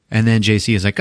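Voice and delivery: ordinary talk, nothing marked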